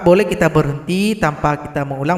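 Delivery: normal talking voice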